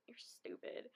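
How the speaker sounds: mock whisper, under the breath; a muttered put-down whispered aside; whispered insult. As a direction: whisper it dryly to yourself. Retorting to friend.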